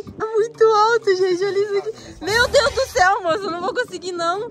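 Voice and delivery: high, loud, frightened pleading with a crack of tears; panicking at the height, nearly crying; real fear of heights. Real, not acted. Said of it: "Gente, eu tô tremendo já". (medo real, voz aguda e chorosa)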